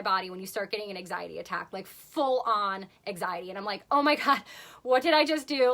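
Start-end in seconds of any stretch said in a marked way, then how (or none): 3.9-5.7 higher, louder panicked question; mock panic. Realising the couscous had gluten, panicked. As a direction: let the panic rise in the voice. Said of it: "I'm in full panic mode".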